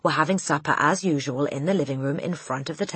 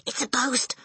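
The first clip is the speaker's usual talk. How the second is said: awed alarm; 10 semitones higher than usual. A narrator's reading. Girl feigns fear of ghost.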